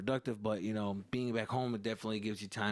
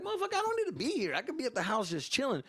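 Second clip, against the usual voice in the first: much higher, fast annoyed grumble; annoyed son; 13 semitones higher, faster than usual. Retold or imitated. Son griping about helping his dad, high and fast. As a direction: vent under the breath.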